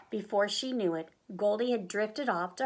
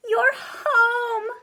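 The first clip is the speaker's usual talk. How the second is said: high, loud squeal of welcome; joyful shout; 13 semitones higher, 8 dB louder than usual. A narrator's reading. Goldie wakes and greets the bears, joyful shout. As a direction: cry it out, overjoyed. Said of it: "Goldie shouted".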